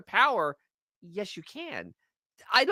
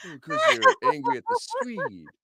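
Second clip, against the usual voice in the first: much higher put-on voice; mock high voice; 4 dB louder than usual. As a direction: squeak out a silly excuse. Mock quoting an explanation.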